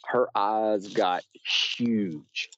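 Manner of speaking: usual speaking voice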